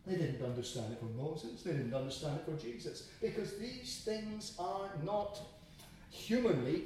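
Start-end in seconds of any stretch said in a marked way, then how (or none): none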